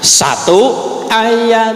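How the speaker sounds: ordinary talk, nothing marked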